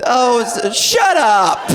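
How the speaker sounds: normal talking voice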